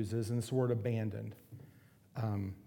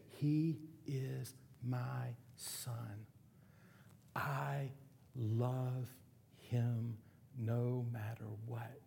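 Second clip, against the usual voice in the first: tender slow quote; 6 dB quieter, much slower than usual. Retold or imitated. Mom's tender words about her son.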